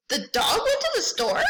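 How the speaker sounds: "The dog went to the store?" asked high and puzzled, with a laugh; question tune. Shown as a demonstration. Librarian showing a question mark to students, questioning tone. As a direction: ask it as a real question. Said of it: "See how my tone changes?".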